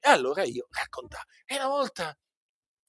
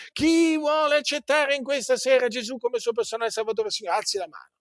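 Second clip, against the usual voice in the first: higher, imitating an evangelist's altar call; mocking altar call; 8 semitones higher than usual. Mocking the altar-call preacher to his congregation, raised pitch. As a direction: ham up the preacher's invitation. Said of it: "alla chiamata all'altare".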